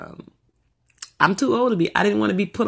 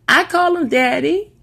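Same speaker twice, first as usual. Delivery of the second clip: put-on little girl voice; 12 semitones higher, 6 dB louder, slower than usual. Daughter quoted again.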